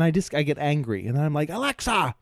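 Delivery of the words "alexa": mock yell. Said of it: "I just kept yelling".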